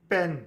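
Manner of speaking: पेन with a strong burst of air on the P; strong puff on P. Shown as a demonstration. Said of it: "पी पर strong puff पड़ रहा है".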